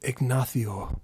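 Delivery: Ignacio with a Castilian "th" for the c; Spanish flair. Shown as a demonstration. Streamer showing off Ignacio with a Spanish accent, lisped "th". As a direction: say Ignacio low, as "ig-NATH-yo", in Spanish. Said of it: "with the Spanish flair".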